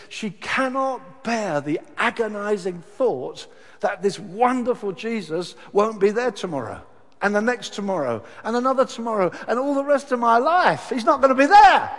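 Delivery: builds from firm to a loud, high cry; building anguish. Acted. Preacher pleading Mary's grief to the congregation, building loud. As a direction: climb step by step into despair.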